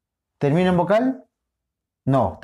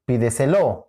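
"pídeselo" stressed as pi-de-se-LO; stress on last syllable; 3 semitones lower than usual. Shown as a demonstration. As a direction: punch the final LO of "pídeselo". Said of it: "¿Cuál es?". («pídeselo» con acento en la última: pi-de-se-LÓ)

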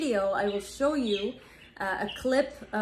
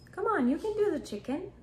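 ordinary talk, nothing marked

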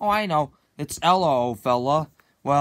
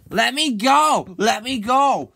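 higher, louder, slow begging yell; pleading shout; 6 semitones higher, 5 dB louder, slower than usual. Acted. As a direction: beg loudly to be freed. Struggling to escape friend.

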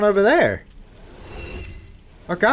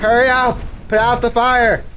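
urgent call; 5 semitones higher than usual. Pretend. Urging haste in the game.

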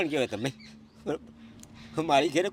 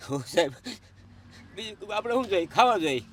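tearful plea, voice breaking; weeping while he pleads; real tears through the words; crying; 5 semitones higher, 5 dB louder than usual. Real, not acted. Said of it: "પહેલાં તો તમે રડો નહીં".

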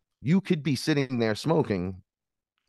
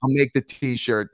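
sarcastic agreement; 4 semitones higher, 7 dB louder than usual. Retold or imitated. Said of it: "So I was like".

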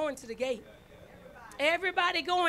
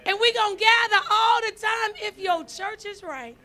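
preaching at full force; 3 semitones higher, 7 dB louder than usual. Acted. Pastor rallying the saints to gather, full-force preaching. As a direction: lift the congregation up.

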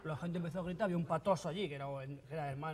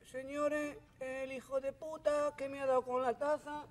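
high, slow serene forgiveness; saintly guru; 8 semitones higher, 4 dB louder, slower than usual. Retold or imitated. Bleeding guru forgives the attacker, high serene calm. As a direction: forgive like a gentle holy man. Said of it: "Ahora soy muy correcto".

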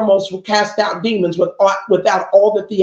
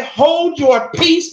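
higher, very slow, calm command; spoken with authority; 8 semitones higher, much slower than usual. Shown as a demonstration. Preacher shows Jesus commanding the demon, calm and slow with authority. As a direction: speak it with authority, never yell. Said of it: "He spoke it with authority".

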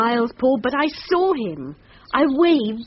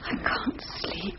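hushed, slow whisper in the dark; 3 semitones lower, 11 dB quieter, slower than usual. Acted. Wife whispering to her husband in bed at night, hushed whisper. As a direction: breathe it so as not to wake him.

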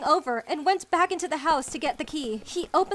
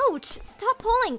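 higher-pitched yelp of protest; hurt, raised-pitch complaint; pained protest; 8 semitones higher, 4 dB louder than usual. Acted. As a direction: object while being pulled by the arm. Being dragged by the arm.

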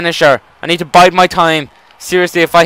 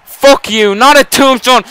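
ordinary talk, nothing marked

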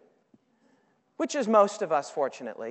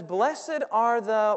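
slow prompt; much slower than usual. Shown as a demonstration. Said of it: "what does your Bible say".

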